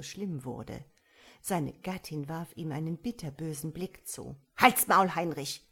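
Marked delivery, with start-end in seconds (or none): 4.6-5.6 loud, furious outburst; screeching. A narrator's reading. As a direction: shout your husband down. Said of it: "kreischte sie ihn an". (wütend kreischend)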